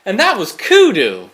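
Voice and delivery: loud, upbeat DJ sign-off; radio host voice. Pretend. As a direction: announce it like a radio DJ. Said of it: "All right, ready, ready?".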